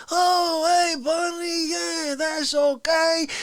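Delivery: high, slow, suddenly friendly; friendly Fauci voice. Retold or imitated. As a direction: switch to a chummy buddy tone. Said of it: "He's like".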